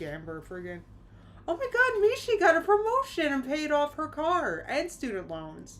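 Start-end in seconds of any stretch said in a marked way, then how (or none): 1.5-5.8 much higher and louder with joy; excited squeal. Real, not acted. Streamer cheers a viewer's paid-off loans, thrilled. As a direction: gush over a viewer's promotion.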